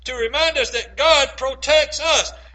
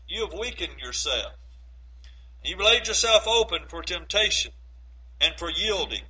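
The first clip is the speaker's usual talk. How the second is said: quieter, lower, sincere urging; earnest softer appeal; 5 semitones lower, 6 dB quieter than usual. Real, not acted. Pastor urging listeners to know the word, soft and earnest. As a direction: draw them in close and plead gently.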